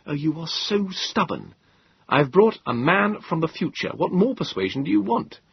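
a touch of wry modesty; young Moses. Acted. Moses voiced by narrator.

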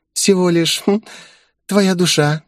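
high, sweetly innocent little voice; innocent smile. A narrator's reading. Demoness naming her price for a contract, innocent. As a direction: pitch it up, pause coyly with a hum. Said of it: "невинно улыбнувшись, ответила она".

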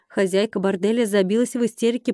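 usual speaking voice

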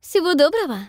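bright, high, loud farewell; cheerful exclamation. Acted. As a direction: call it out gaily. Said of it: "весело восклицает".

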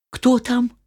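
high little goats asking; kid goats voice. Acted. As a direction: squeak the question. Kids ask.

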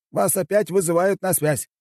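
high-pitched assistant voice; помощник председателя. A narrator's reading. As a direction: call the boss in a high voice. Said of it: "В комнату забежал первый помощник". (высокий голос помощника)

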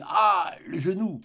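brief narrated cry of pain. A narrator's reading. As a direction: cry out, then drop back. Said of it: "je m'écriai".

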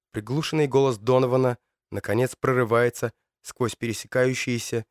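ordinary delivery

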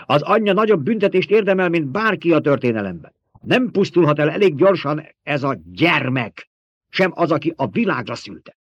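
loud, high-pitched tirade; angry denunciation. A narrator's reading. Old woman cursing the child and its mother, angry denunciation.

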